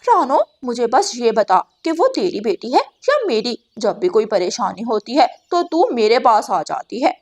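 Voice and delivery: loud, mocking crow neighbour; scheming crow. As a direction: sneer like a greedy crow. Narrator voices scheming crow Gauri.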